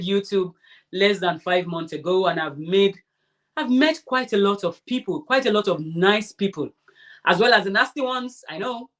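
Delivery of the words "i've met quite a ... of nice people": ordinary talk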